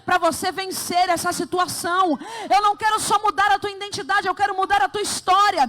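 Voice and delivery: loud, high proclamation in God's voice; preaching at full force. Acted. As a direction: pour it out over the church.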